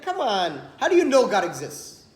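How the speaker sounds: laughing, scoffing challenge; mocking laugh. Guards mocking the rabbi, laughing scoffing question. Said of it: "And they're laughing".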